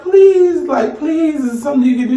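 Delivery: high-pitched pleading, no real crying; retold tearful plea. Stranded customer begs the tow driver, imitated tearful plea. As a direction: draw out "please" high, mock-begging. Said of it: "I'm crying".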